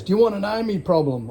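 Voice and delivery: lightly voiced aggressive stranger; imitating the other driver. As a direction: throw his snarl back as a mimic. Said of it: "and then he goes".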